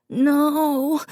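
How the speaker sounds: put-on a little boy wailing in despair; child's wail. A narrator's reading. Davy wailing at Dad, very high. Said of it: "Davy wailed".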